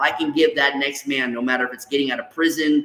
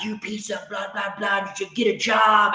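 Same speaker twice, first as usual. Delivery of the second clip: retold angry yelling; 6 semitones higher, 3 dB quieter than usual. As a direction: imitate yourself ranting at someone, pitched up. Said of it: "I'm screaming and yelling at him".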